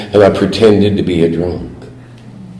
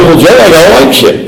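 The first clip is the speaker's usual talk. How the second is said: a bit higher, trying again to be heard; eager announcement, repeated; 6 semitones higher, 3 dB louder than usual. Retold or imitated. Nephew repeating himself to his ignoring uncle, eagerly. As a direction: say it again, he isn't listening. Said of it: "And I said".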